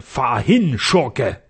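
forceful call in a changed voice; heroic battle cry. A narrator's reading. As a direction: cry it out at a villain as you strike. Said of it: "als er diese Worte rief".

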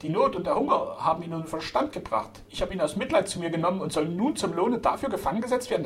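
high-pitched outraged protest; feigned indignation. A narrator's reading. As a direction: protest as the wronged innocent. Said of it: "mit gespielter Entrüstung".

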